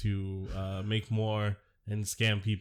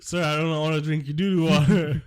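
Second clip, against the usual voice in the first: raised, louder mock protest; balking senator; 5 semitones higher, 7 dB louder than usual. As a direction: play the senator objecting to the glass. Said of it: "And he's like".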